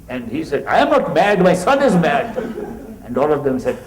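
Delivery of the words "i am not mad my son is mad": higher and louder, outraged denial; tied-up father insisting to the neighbours that his son is the mad one; indignant yell. Retold or imitated. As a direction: shout it back at the crowd.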